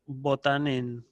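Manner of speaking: pronunciación lenta. Shown as a demonstration. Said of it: "para que quede mejor".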